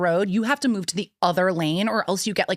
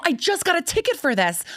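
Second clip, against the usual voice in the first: exasperated protest; 10 semitones higher than usual. Retold or imitated. Mom arguing with the cop, high disbelief. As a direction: whine it in disbelief. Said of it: "And I go".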